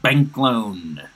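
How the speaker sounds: slow, carefully enunciated warm-up line; over-articulate every word slowly; prim precise elocution. Shown as a demonstration. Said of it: "loosening up".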